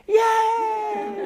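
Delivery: high, drawn-out forced "yay"; mock cheer. Dad giving the right answer to baby news, mock cheer. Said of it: "the right answer is".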